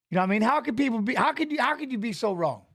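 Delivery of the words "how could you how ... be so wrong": ordinary talk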